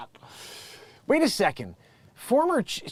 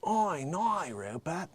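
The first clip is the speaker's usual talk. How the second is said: softer mock Australian woman; Australian accent; 8 dB quieter, slower than usual. Bride talking to Rupert, soft imitated Australian accent. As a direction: imitate her quietly with Australian vowels. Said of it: "in Australian".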